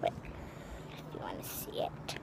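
faint whisper. Real, not acted. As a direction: breathe it out quietly. Still whispering indoors.

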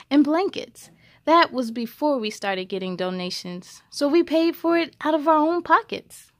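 clear, even read-aloud voice; story narration. A narrator's reading. Host reading a picture book to young viewers, storytelling voice.

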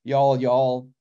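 attempted Southern accent, a little low; respectful Southern accent. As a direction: try on a polite Southern US accent. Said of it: "make a respectful Southern accent".

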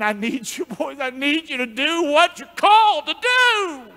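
rising to a loud, high call; Jesus rallying his disciples; urgent plea. Acted. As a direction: plead with them, building up. Voicing Jesus calling the disciples.

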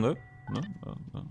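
ordinary talk

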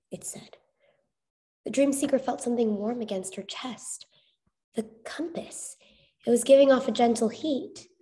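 ordinary delivery

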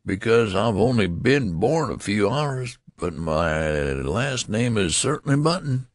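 put-on high, swooping old man's whine; querulous whine. A narrator's reading. Old man explaining his birth, querulous whine. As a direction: keep complaining in a high, wavy whine. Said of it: "replied the querulous whine".

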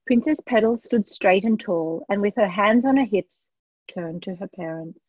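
ordinary delivery, nothing marked

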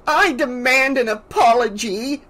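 high-pitched, affronted demand; sputtering indignation. A narrator's reading. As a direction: insist in shrill outrage. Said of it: "Missus Deagle sputtered".

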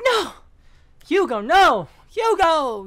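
high, loud mock cry for Hugo; imitating her cry. Streamer imitating sister crying out to Hugo, hammed-up shout. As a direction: call after the boy in mock despair. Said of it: "She was like".

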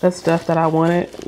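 ordinary talk

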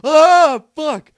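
very high, very loud real scream; terrified scream. Real, not acted. Player hit by a jump scare at level sixty-nine, terrified scream. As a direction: scream the curse in sudden fright.

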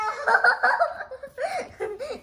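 high burst of giggles; real giggle after the silly shout; laughing. Real, not acted. Kid cracking up at own silly shout.

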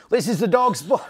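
high, loud British try collapsing into laughter; laughing British accent. Pretend. Asked to repeat, host laughs through the British accent. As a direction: start the accent and crack up. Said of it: "do it again?".

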